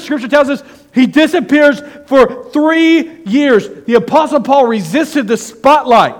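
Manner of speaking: loud, high, climbing preacher's cry; preaching at full force. Acted. Pastor rousing the congregation, preaching at full force. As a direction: preach it out with rising fire.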